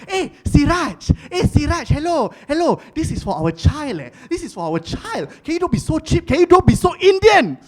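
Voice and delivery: high, pleading mock wife voice; nagging wife. Wife scolding husband about buying, high whiny voice. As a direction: whine at him like an exasperated wife. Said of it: "My wife".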